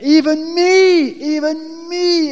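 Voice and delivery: a loud, high emphatic even me. Real, not acted. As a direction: build it up, almost crying out. Preacher stressing his own light weight.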